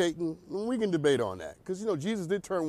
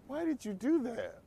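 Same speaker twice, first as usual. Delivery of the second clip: a light mock-puzzled grandpa, bit higher; imitating grandpa; 7 semitones higher, 7 dB quieter than usual. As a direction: quote the grandpa as if it were a problem. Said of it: "when he says".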